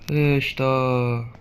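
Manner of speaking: very slow, stretched "قشطة"; "قشطة" stretched long and slow; drawn-out playful. Pretend. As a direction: savour the word lazily.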